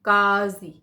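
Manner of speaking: काजी with a throaty uvular q; nukta pronunciation. Shown as a demonstration. Teacher reading the word with the nukta, throaty काजी. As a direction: say काजी as क़ाज़ी. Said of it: "इसको का पढ़ना है".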